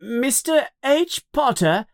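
high, put-on boy reading aloud; child's yell. A narrator's reading. Dudley reads the envelope to his parents, high yell. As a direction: call the name out in a high voice. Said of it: "he yells to his parents".

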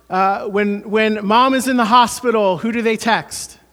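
loud slow pulpit fervor; preaching at full force. Acted. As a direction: drive the point home to the congregation.

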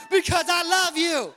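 loud, high acted outburst; full-force exasperated-sounding declaration; emphatic shout. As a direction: shout it back as the final answer. Shouted climax of acted exchange.

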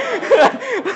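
squeaky laugh burst; high nervous laugh. Real, not acted. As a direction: giggle at your own panic. Nervous laugh recalling fumbling.

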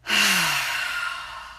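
long audible sigh out; deep sigh. Shown as a demonstration. Yoga teacher showing students how to sigh out, long releasing sigh. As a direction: let a big breath fall away in a sigh. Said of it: "Sigh the first few out".

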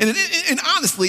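usual speaking voice

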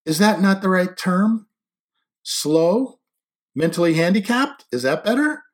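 high, loud string of questions; mock-helpful needling. A narrator's reading. She offers him other terms for the insult, needling. Acted argument over an insulting term.

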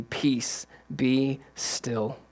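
Jesus speaking peace to the sea; calm command. Acted. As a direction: hush the storm gently. Jesus calms the storm.

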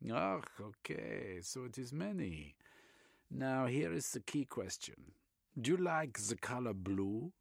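put-on Reich in a German accent, eagerly expounding.

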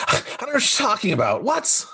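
high, rushed protest; panicked denial. Acted. Game master as the accused captive on the train, panicked denial. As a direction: blurt out a fast, high-pitched denial.

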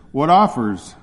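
loud call for bids; auctioneer's call. Acted. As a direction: call it out across the crowd.

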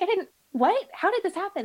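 high, startled disbelief; retold shock at the surgeon's news. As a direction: caught off guard by the news, voice jumping up.

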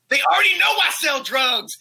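high, yelling mock outrage; pissed off. Imitating the busted dealer yelling at informants, pissed. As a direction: yell it high, imitating an indignant protester. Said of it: "He was pissed".

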